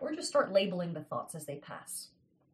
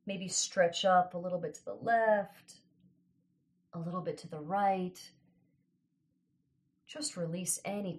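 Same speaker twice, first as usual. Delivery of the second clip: calm grounding exercise. Acted. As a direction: ease them into stillness. Leads grounding breathing exercise.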